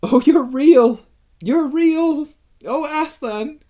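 high, loud, overjoyed exclamations; joyful cry. A narrator's reading. Lucy flinging herself upon the lion, joyful cry. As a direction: burst out with delighted relief. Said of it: "cried Lucy".

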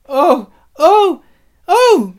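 very high, loud wails of dismay; anguished cry. A narrator's reading. Two girls rushing back to the table, crying out. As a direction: cry out full voice in shock. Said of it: "cried the two girls".

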